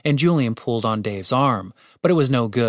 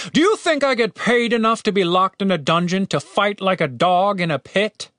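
high-pitched exasperated rant; fed-up rhetorical question, pitched up; indignant leader; 8 semitones higher than usual. A narrator's reading. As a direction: demand it of a doubting underling. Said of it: "The leader glared at the soldier".

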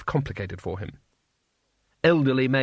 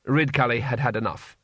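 usual speaking voice

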